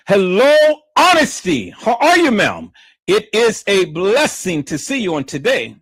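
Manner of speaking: bright, high hello to a viewer; cheerful greeting. Real, not acted. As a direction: greet them warmly and loud.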